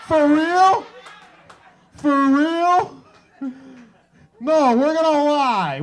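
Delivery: high, loud mock disbelief; mock incredulous. Host mocking the audience's doubt, high loud mock disbelief. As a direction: ham up the crowd's doubt.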